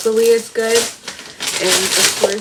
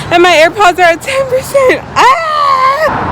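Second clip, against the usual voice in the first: whiny wail; 10 dB louder than usual. Real, not acted. AirPods dying mid-run.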